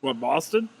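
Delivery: normal talking voice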